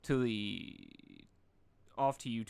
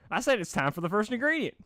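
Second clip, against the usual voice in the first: higher, louder fake cheer; mock joy; 9 semitones higher, 7 dB louder than usual. A narrator's reading. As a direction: sweeten it with fake delight. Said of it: "corrected Pinkamena in mock joy".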